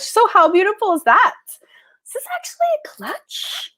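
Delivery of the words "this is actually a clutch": breathy whisper, much higher. Real, not acted. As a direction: hush it like a secret. Showing off a product softly.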